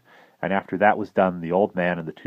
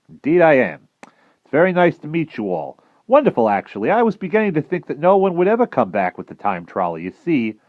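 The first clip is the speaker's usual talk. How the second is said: higher, louder genial greeting; relieved gentleman; 9 semitones higher, 6 dB louder than usual. Acted. Townsend welcoming the travelers, genial and relieved. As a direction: sound glad to see them.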